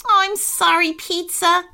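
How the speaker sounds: high, tearful apology; teary voice. Acted. Thad apologises to Pizza in the hole, teary. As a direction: apologise as if about to sob. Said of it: "said Thad in a teary voice".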